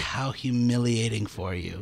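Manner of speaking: lightly voiced, low slow sympathy; mock-sympathetic, slightly slowed quote; imitating Pam. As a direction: draw it out softly and low. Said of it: "And then she's like".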